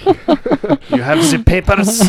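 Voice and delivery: slow, high German accent, hammed up; bad German accent. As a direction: play a cartoon German officer accent. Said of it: "I can't do a German accent".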